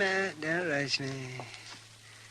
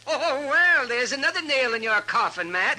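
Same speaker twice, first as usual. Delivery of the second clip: much higher and louder, relishing the joke; gloating tease; 7 semitones higher, 6 dB louder than usual. Acted. [name] needles Matt about the woman, loud gleeful teasing. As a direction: crow over him like a friend enjoying it.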